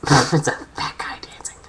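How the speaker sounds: breathless giggly whisper; laughing whisper. Real, not acted. Laughing before burp take.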